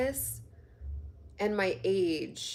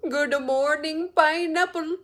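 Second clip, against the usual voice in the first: mock Indian accent; 6 semitones higher than usual. Viewer imitating an Indian man's morning greeting video, sing-song mock Indian accent. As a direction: croon it like a cheery Indian uncle greeting you. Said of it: "he's like".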